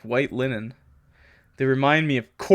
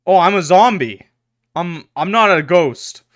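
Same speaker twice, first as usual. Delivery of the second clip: excited realisation; 3 semitones higher, 9 dB louder than usual. Real, not acted. Realising he's a zombie.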